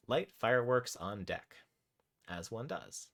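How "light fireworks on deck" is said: ordinary talk, nothing marked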